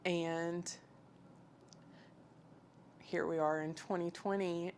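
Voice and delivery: usual speaking voice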